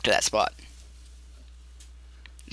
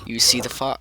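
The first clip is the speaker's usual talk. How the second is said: ordinary delivery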